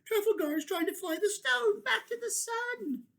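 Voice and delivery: put-on high child's voice, anxious; small creature fretting; high worried child voice. Baby kin continues.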